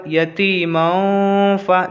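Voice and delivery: "यतीमों फ़ा" slowly, "मों" held in the nose; ikhfa nasal hold. Shown as a demonstration. Teacher shows ikhfa before fa, nasal hold. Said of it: "इसको भी वैसे ही पढ़ेंगे".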